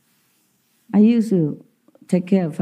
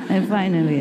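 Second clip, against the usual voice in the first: ordinary talk, nothing marked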